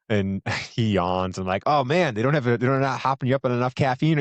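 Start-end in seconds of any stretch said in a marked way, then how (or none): 1.6-4.2 higher, louder jokey teasing; corny dad joke. Retold or imitated. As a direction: rib the tired clerk like a goofy dad. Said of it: "making my corny dad jokes".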